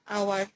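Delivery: আওয়ার said opening on a vowel, no h; আওয়ার with a silent h; silent h demo. Shown as a demonstration. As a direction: start আওয়ার straight on the vowel, no breath of h. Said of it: "এইচ এর উচ্চারণটা কিন্তু আমার আসছে না".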